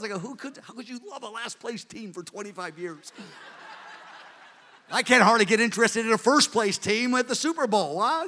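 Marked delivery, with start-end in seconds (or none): 0.2-3.1 hushed, laughing disbelief; laughing tease. Retold or imitated. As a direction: tease him softly through a laugh. Teasing brother about losing team.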